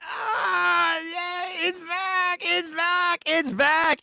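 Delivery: high cry of joy; ecstatic squeal. Real, not acted. Fan squealing at the stream that it's back. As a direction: squeal it out, coming down bit by bit.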